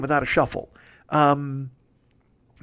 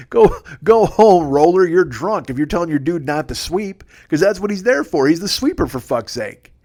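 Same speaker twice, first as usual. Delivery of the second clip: louder, higher heckle at a curler; mock telling-off; 4 semitones higher, 6 dB louder than usual. Heckling the shouting curler in his head, a mock loud rant. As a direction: scold him like a bar heckler. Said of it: "in my head, I'm like".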